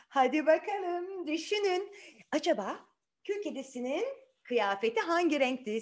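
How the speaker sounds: ordinary delivery, nothing marked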